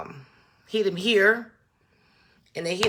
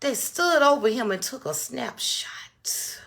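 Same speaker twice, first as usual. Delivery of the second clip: whisper; 2 semitones higher, 6 dB quieter than usual. Real, not acted. Describing the photo to viewers, horrified whisper. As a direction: barely breathe it out in disbelief.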